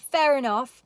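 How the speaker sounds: normal talking voice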